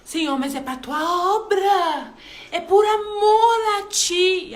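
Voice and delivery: pleading protest. Retold or imitated. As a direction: protest to God slowly and earnestly. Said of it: "reclamando com Deus". (súplica lenta e cada vez mais alta)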